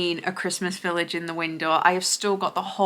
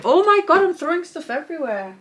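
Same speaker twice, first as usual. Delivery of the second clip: surprised exclamation; 8 semitones higher than usual. Real, not acted. Vlogger suddenly exclaiming over her Primark haul, surprised. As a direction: blurt it out, caught off guard.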